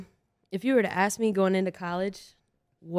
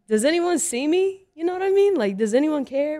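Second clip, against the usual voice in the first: much higher, louder, mock-desperate cry; exasperated plea; 9 semitones higher, 6 dB louder than usual. A star player answering professors who cheer her on, exasperated. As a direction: throw it up to the sky in disbelief.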